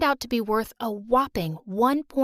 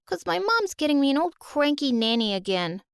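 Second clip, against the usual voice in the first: put-on sulky little girl; 5 semitones higher than usual. Little girl voiced by narrator.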